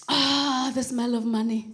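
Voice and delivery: low, satisfied sigh of pleasure; low acted contentment; savouring. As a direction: breathe in luxury, drawing out the "Ah". Acted savouring the shop's smell.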